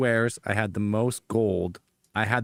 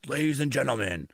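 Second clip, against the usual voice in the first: announcer voice; 3 semitones higher than usual. Retold or imitated. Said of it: "they're like".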